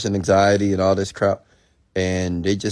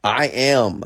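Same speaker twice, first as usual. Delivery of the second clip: higher, emphatic mock claim; challenging insistence; 5 semitones higher, 4 dB louder than usual. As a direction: state I AM firmly, imitating the challenger. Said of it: "they will challenge me".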